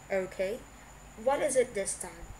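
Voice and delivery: a touch of an irritated snap; understated exasperation; mild snap. A narrator's reading. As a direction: brace for bad news, impatient. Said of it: "Blaine snapped".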